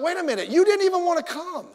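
retelling his indignant protest at the buddy who went first. Retold or imitated. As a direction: replay your old outrage at your buddy.

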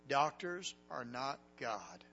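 slow, solemn words from God; slow inner voice of God. Retold or imitated. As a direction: let each word land slowly, like God speaking in the heart. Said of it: "I heard it again".

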